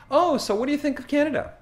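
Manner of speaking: high-pitched, rapid small talk; quick, high, casual asking; fast chatty question. Retold or imitated. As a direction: imitate a relative rattling off a friendly question. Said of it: "that was speaking slowly".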